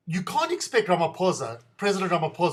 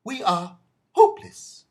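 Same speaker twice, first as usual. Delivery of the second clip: usual speaking voice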